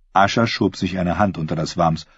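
ordinary delivery

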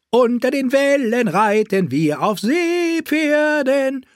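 high, slow sing-song jester; giddy singsong court fool; jolly fool. Acted. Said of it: "Seine Glöckchen läuteten fröhlich".

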